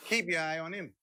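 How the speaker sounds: ordinary talk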